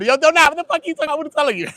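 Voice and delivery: loud, high and fast; excited shout. Real, not acted. Reacting to YouTube crowd rushing over, loud and fast. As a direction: fire it off in disbelief.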